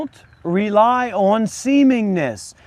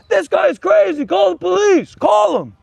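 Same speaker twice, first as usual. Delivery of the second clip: mock panicked bystander; 8 semitones higher than usual. Mimicking bystanders calling police.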